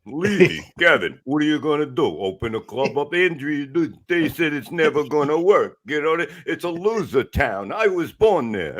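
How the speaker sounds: Sylvester Stallone impression. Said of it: "this is Sylvester Stallone".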